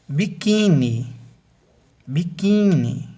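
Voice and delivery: "biquíni" slowly, QUI high and stretched; biQUIIIni, swelling middle syllable; stress on QUI. Shown as a demonstration. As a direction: lift and draw out the middle of "biquíni". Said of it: "a sílaba tônica é o qui".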